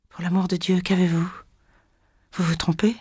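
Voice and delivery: supplique plus douce, hésitante; balbutiant. Acted. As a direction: bafouille sous le choc. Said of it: "balbutia Irène". (Irène supplie la femme, balbutiante)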